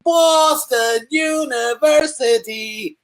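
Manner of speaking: high, slow mock Boston drawl; Boston accent. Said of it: "do you say Boston?".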